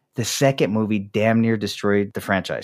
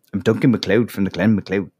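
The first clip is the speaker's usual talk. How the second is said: put-on a lightly done Scottish accent; mock Scottish accent; 3 semitones lower than usual. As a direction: quote the Highlander line in a hint of Scottish. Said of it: "I'm not supposed to do the accent right".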